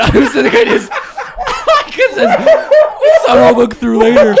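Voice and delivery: very high, loud, squealing with laughter; real laughing fit while explaining yourself; helpless laughter. Real, not acted. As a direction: barely get the words out for laughing. Storyteller cracks up mid-story.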